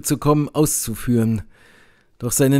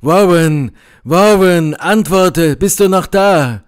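loud, raised, urgent calls; calling and shouting; 5 semitones higher, 6 dB louder than usual. A narrator's reading. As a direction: call desperately into a silent telephone. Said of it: "rief und schrie". (drängend rufen und schreien)

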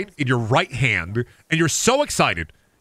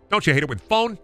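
usual speaking voice

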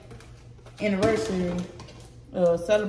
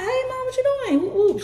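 high-pitched, chirpy hello to mom; imitating a carefree phone hello; retold carefree greeting; 3 dB louder, faster than usual. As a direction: sing out a light, unsuspecting greeting, much higher. Retold cheerful call to mom.

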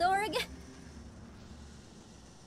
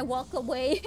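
ordinary delivery

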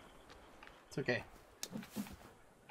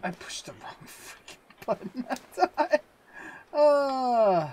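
higher, louder exasperated burst at the button; frustrated outburst; 10 semitones higher, 8 dB louder, slower than usual. Real, not acted. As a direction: blurt it out, fed up with yourself. Game misclick frustration.

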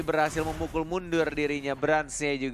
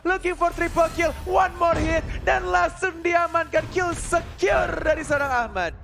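fighting game announcer; 5 dB louder than usual. Real, not acted. Caster hypes a multi-kill.

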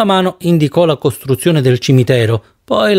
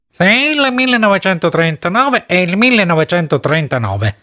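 shrill and high; 3 semitones higher than usual. A narrator's reading. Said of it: "disse in tono acuto e stridulo".

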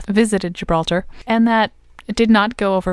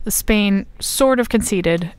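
ordinary delivery

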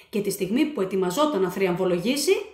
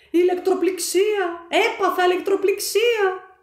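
much higher, louder jolted cry; shocked diver; 10 semitones higher, 6 dB louder than usual. A narrator's reading. The electrocuted diver cries out, high and loud. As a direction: yell as the shock hits.

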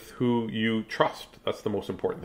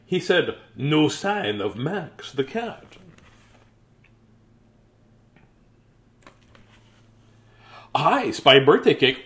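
lively, slow storybook reading; reading to children; 6 semitones higher, 6 dB louder than usual. Acted. Teacher reading a birthday picture book, lively and slow. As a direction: read it out big for the kids.

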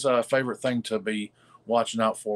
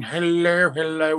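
higher, louder drawn-out greeting; mock group greeting; 5 semitones higher, 6 dB louder, slower than usual. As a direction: imitate a crowd's cheery hellos. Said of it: "everybody's just saying".

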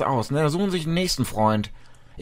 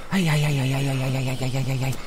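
low, quiet string of ei-ei-ei; soft, monotone chant; muttered tutting; 5 semitones lower, 5 dB quieter than usual. Real, not acted. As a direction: mutter it under your breath. Muttering while looting the body.

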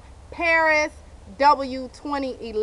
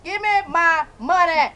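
angry demand; 3 semitones higher, 6 dB louder than usual. Acted. Yelling at the shoe store.

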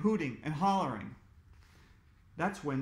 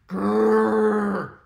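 loud growling bear; 3 semitones higher, 12 dB louder than usual. A narrator's reading. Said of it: "growled the bear".

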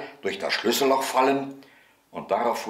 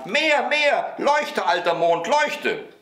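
put-on high, insistent little boy's yell; shrieked command; 13 semitones higher, 4 dB louder, slower than usual. A narrator's reading. Boy orders the old moon to shine, high shriek. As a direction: squeal orders like a greedy child. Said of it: "schrie der Häwelmann".

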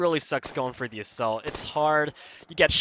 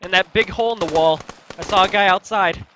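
higher, louder urgent report; urgent callout; 4 semitones higher, 7 dB louder than usual. Real, not acted. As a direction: shout the enemy position. Callout in firefight.

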